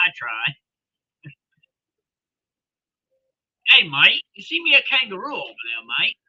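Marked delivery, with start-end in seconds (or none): 3.7-6.2 playful put-on Australian accent. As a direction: do an amateur Australian impersonation. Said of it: "He tries to be a Australian accent".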